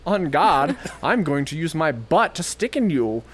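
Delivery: high-pitched theatrical insect challenge; mock wasp duelist. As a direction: announce the attack like a pompous little fencer. Wasp fencing with its stinger.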